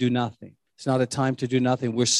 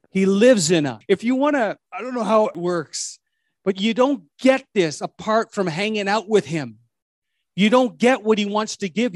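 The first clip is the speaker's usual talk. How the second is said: higher, louder fervent preaching; preaching at full force; 6 semitones higher, 3 dB louder than usual. Acted. Pastor closing his sermon to the church, fervent preaching. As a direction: preach it with rising fire.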